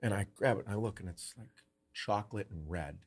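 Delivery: ordinary delivery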